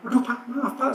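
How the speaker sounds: retold flustered apology. Driver apologises to the angry gate guard, retold flustered apology. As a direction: apologise hastily as if caught out, acted lightly.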